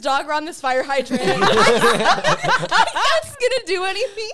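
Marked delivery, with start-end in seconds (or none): 1.2-4.3 high, loud, slow words through laughter; helpless laughter. Real, not acted. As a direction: crack up while telling it. Laughing at own dog story.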